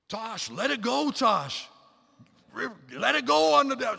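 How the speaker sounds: loud, high, drawn-out shout across a course; coaching yell. Retold or imitated. Dad coaching his runner from the bush, loud drawn-out yell. Coaching daughter during a race.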